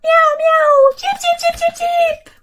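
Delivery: high meows then chirps; cat and bird calling together; animal noises. Acted. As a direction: meow then tweet in a rush. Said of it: "le chat miaule, l'oiseau piaille".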